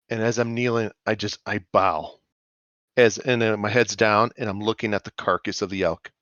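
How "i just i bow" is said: ordinary talk, nothing marked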